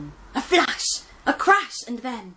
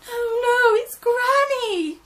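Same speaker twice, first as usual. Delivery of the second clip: Gran crying out; 9 dB louder than usual. Acted. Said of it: "Granny cried".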